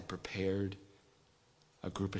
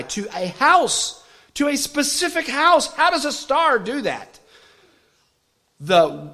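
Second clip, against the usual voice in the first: rising, loud preacher's cry; preaching at full force; 9 semitones higher, 12 dB louder than usual. Acted. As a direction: build the sermon up to a loud, high peak.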